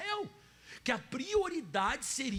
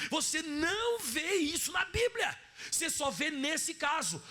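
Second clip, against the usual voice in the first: raised, near-shouting sermon voice; full-force preaching; 6 semitones higher than usual. Acted. Preacher pressing the church hard, near shouting. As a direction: drive the point home to the congregation.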